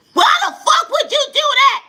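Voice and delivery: yelling relative. Retold or imitated. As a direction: yell it like a furious family member on the phone. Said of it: "they yelling at you".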